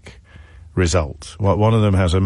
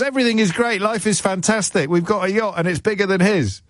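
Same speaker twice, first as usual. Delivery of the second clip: high, bright mock glee; high falsetto glee; manic cheer; 11 semitones higher than usual. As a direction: squeak it out in forced delight. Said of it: "comes back and goes".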